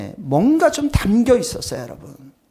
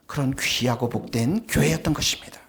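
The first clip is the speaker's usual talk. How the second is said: hushed whisper; 9 semitones lower, 8 dB quieter than usual. Real, not acted. Preacher whispering to his congregation, hushed and reverent. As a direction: drop to an unvoiced whisper. Sermon point sinks to whisper.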